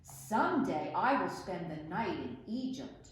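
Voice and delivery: ordinary delivery, nothing marked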